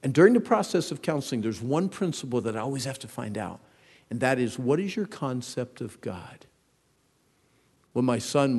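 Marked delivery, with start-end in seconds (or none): none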